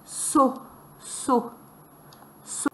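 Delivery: "so" sounded out by itself; isolated syllable. Shown as a demonstration. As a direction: say "so" as a lone syllable, with pauses. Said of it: "Quel bruit cela fait?".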